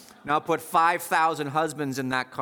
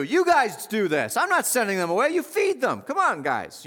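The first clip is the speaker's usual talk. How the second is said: Jesus giving orders; 6 semitones higher, 3 dB louder than usual. Retold or imitated. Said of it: "Jesus is gonna tell the disciples what to do".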